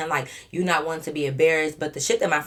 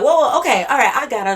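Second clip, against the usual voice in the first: much higher, louder calming shout; mock alarmed stepping in; 7 semitones higher, 8 dB louder than usual. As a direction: jump in to calm things down. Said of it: "my homegirl stepped in like".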